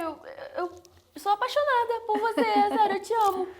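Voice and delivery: high, loud burst of affection; real, overflowing adoration; gushing declaration. Real, not acted. Gushing declaration of love to her idol.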